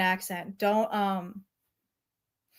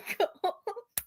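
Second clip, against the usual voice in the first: giggle; 7 semitones higher, 10 dB quieter than usual. Real, not acted. Laugh after line.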